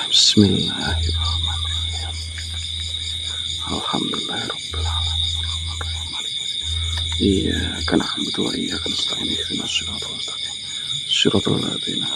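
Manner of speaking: soft, unvoiced recitation of Arabic prayer; whispered prayer. Vlogger prays over the possessed man, whispered. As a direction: murmur the verses under your breath.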